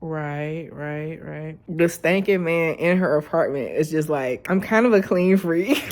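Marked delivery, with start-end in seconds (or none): none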